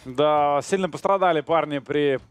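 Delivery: ordinary talk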